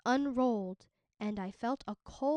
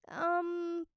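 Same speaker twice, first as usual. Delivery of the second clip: high, unsure hesitation; hesitant girl; 7 semitones higher, 3 dB louder than usual. A narrator's reading. Minli hesitating before breaking the news, high and unsure. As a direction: stall before letting him down. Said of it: "Minli said".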